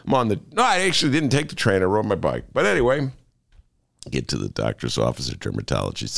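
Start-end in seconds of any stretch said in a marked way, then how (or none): 4.1-6.2 woozy narration. Acted. Trippy deadpan narration.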